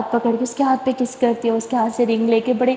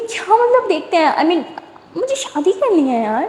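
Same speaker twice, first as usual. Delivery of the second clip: pouty insistence; 6 semitones higher than usual. A narrator's reading.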